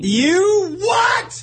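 high, loud shocked cry; mock outrage. Cohost hears he hates Halloween, a high mock-shocked shout. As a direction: act scandalised at the news.

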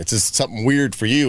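ordinary talk

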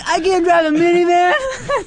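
a light, mock-weepy whine; whiny crying kid. Mother imitating spoiled kids, mild mock whimper. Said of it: "They start crying".

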